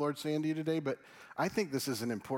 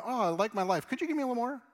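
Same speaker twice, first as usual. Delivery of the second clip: high-pitched, louder mock plea; self-satisfied asker; 7 semitones higher, 3 dB louder, faster than usual. Playing a churchgoer happy with life asking for more, high mock plea. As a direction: put on a higher, eager voice for the request. Acting out a contented person wanting more.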